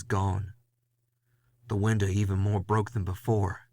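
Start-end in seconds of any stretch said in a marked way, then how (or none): none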